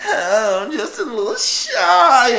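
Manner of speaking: put-on high, slow coy girl. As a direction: play shy and sweet like the movie girl. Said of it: "where the bitch is like".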